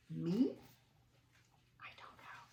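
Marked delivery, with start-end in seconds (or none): 1.8-2.4 coy whispered denial. Acted. Teasing the children about the scribble, whispered. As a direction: whisper it like a little secret.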